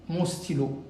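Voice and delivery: normal talking voice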